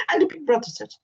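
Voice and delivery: usual speaking voice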